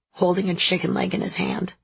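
ordinary delivery, nothing marked

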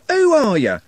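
the question cracks into a scream; furious scream. A narrator's reading. Trev rounding on the others, screaming. Trev repeats, building rage.

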